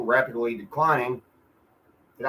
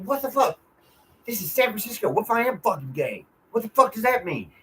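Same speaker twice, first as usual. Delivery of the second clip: higher, incredulous retort to the neighbor; indignant retort; 8 semitones higher than usual. Retold or imitated. Retelling his comeback to the neighbor who called him queer, indignant and high. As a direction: fire it back, outraged and pitched up. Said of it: "I was like".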